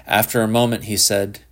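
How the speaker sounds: ordinary delivery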